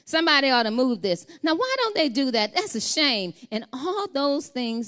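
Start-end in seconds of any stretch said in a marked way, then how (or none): 0.0-3.3 mock grumble that gets louder and higher; complaining bystander. Preacher imitating a churchgoer who just complains, mock grumbling. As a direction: play the onlooker who only complains. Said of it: "This is how it sounds".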